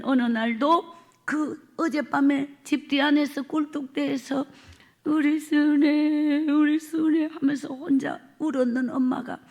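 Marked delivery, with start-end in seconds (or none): 5.0-7.3 slow, grieving repetition of the name; tearful murmur. Retold or imitated. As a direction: linger on the name as if in tears. Mother weeping over daughter.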